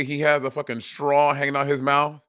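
normal talking voice

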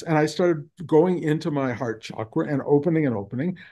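normal talking voice

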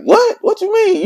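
very high, loud incredulous mock squawk; retold angry disbelief. Man mad at his friend's answer, retold high and indignant. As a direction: act out outraged bafflement. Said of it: "So I'm mad at him".

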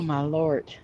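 normal talking voice

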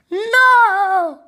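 loud storybook cry of NO. A narrator's reading. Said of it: "cried the anim".

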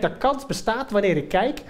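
ordinary delivery

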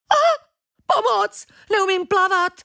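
high, loud panicked screech; a terrified cat shrieking for help; sudden high panicked yelp; desperate cry for help. Acted. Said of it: "prskala zoufale kočka".

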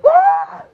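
very high, loud yell; battle scream. Acted. Player attacks, high battle scream.

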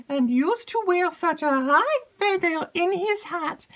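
high, loud eager maid's voice; breathless excited maid. Acted. Maid rushes in to tell Emily news, excited.

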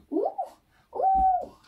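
high squeaky toy voice; squeaky ooh. Pretend. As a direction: squeak like a tiny creature. Figure reacts to a jump.

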